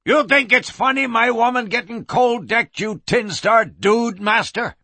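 bawling. Acted. Bleeding miner challenges the lawman, bawling. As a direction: bawl it out before charging. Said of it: "as he bawled".

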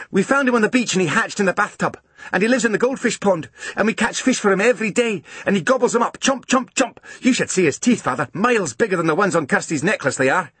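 高音连珠炮似地兴奋讲述; 像孩子抢着讲新鲜事一样一口气说完; 兴奋的小男孩. A narrator's reading. Angus excitedly telling father.

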